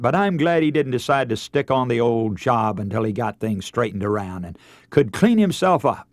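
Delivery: usual speaking voice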